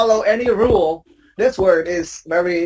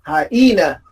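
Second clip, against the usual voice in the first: Hyena with the EE held and stressed; teacher's model hy-EE-na; stress on the middle of hyena. Shown as a demonstration. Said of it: "Very good".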